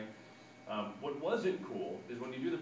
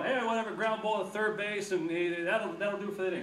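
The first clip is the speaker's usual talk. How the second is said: loud, high mock play-by-play; hasty radio call; 8 semitones higher, 6 dB louder than usual. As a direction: toss off the call to get out fast. Imitates a hurried inning-ending call.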